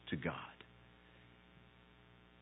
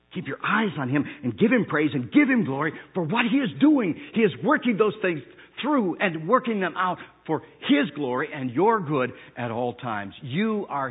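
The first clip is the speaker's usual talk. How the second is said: loud, high preacher's climax; thundering pulpit voice; full-force preaching; 8 semitones higher, 11 dB louder than usual. Acted. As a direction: preach it out to the back row.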